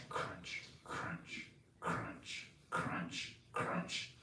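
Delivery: breathy unvoiced crunch, crunch, soft and rhythmic; footsteps crunching. Footsteps of Shiva coming through snow, whispered crunches. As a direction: creep the footsteps in one by one.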